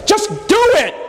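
high, exasperated snap of a fed-up God; exasperated outburst. Retold or imitated. Pastor voices God losing patience with Moses, exasperated. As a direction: blurt it out like a God who's had enough. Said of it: "the Lord blew up".

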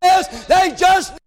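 high, drawn-out pulpit shout; fervent preaching. Real, not acted. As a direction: belt it out to the congregation. Preacher exhorting at peak fervour.

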